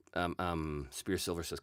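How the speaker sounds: normal talking voice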